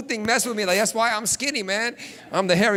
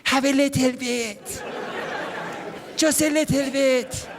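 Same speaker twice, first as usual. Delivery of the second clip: slow, wheedling mom voice; coaxing mom; 3 semitones higher, slower than usual. Retold or imitated. Mom pouring juice and coaxing her son, slow and wheedling. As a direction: draw it out sweetly to tempt.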